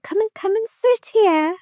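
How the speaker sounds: imitating mum's fake-sweet coaxing; coaxing mother voice. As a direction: lure her back sweetly. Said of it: "And she went".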